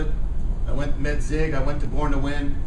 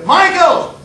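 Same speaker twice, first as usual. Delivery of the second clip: loud, high scolding shout of the name; teacher's sharp call; 11 semitones higher, 8 dB louder than usual. Retold or imitated. Teacher snapping a daydreaming pupil to attention, loud. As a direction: call him back from the window.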